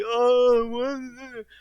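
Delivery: high whiny put-on voice; mock whine. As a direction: whine it like a sulky kid. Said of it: "He's like".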